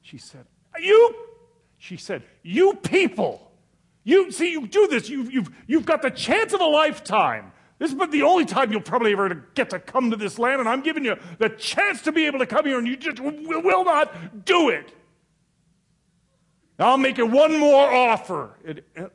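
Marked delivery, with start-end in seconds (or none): 0.8-1.2 sudden high, loud shout of you; yell. Retold or imitated. Saleswoman yelling at the poor couple, sharp loud shout. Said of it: "she yelled at us". 2.4-18.6 high, loud, scolding rant; angry tirade. Retold or imitated. Saleswoman yelling at the couple who won't buy, loud angry scolding. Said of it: "she yelled at us".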